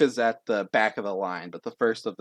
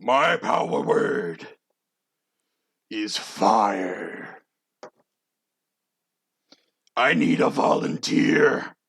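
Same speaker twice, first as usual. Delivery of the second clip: slow, booming giant with a rough edge; big mountain of a man; 4 dB louder, much slower than usual. Acted. Huge stomping contestant to the judges, slow menacing giant. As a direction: intone it slowly and hugely. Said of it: "He's a big mountain of a man".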